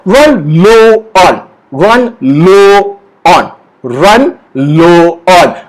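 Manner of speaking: drawn-out words, one at a time; slow model phrase. Shown as a demonstration. Teacher models a tricky phrase, very slow and separated. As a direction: stretch each word for repeating. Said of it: "so say it with me".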